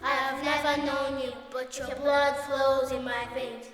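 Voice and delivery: usual speaking voice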